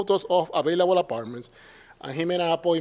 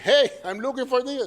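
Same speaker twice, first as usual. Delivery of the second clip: mock cheerful call; 8 semitones higher than usual. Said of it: "I'm like".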